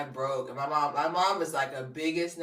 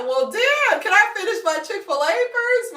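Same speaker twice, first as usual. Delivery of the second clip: high, loud mock-indignant protest; hammed-up exasperated yell; exasperated outburst; 9 dB louder than usual. Woman interrupted while eating answers people, exasperated.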